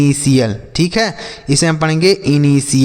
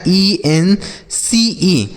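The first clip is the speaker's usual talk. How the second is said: letters drawn out one by one; very slow, letter-by-letter spelling; much slower than usual. Shown as a demonstration. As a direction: spell it very slowly for pupils.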